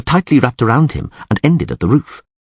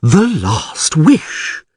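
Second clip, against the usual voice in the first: higher, very drawn-out words; awed repetition; 7 semitones higher, much slower than usual. A narrator's reading. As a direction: dwell on each word of the revelation. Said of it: "repeated the priest".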